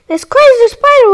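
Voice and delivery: high, loud and fast; excited. Real, not acted. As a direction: blurt it out in a rush. Excited pointing out spider.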